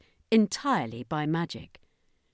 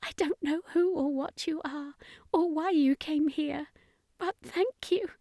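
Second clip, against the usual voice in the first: grateful mother; 10 semitones higher than usual. A narrator's reading. Mother thanks Ashley.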